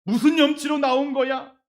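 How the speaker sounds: mild mock cold disdain; cold resentment. Church member coldly questioning a returning sinner, mock disdain. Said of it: "막 냉랭한 마음으로".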